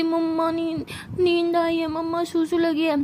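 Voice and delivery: put-on drowsy toddler pleading with mamma; sleepy little cousin. Little cousin answers Sara with eyes shut, sleepy child voice. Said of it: "नन्हा सा cousin जो है वो ऐसे नींद में".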